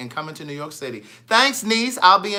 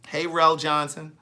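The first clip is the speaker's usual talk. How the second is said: ordinary delivery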